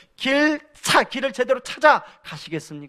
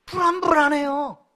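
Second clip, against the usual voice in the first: anxious sigh; 5 semitones higher than usual. Retold or imitated. Pastor sighing to the congregation over his son, 불안불안해요 worried and drawn. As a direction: let 불안불안해요 sag with a fretful fall.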